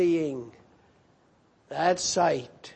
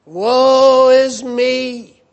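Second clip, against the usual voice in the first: slow loud cry of woe; 4 semitones higher, 8 dB louder, much slower than usual. Acted. Preacher voicing Isaiah's despairing cry of woe. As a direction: draw out woe is me, loud and high. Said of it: "I am a sinful man".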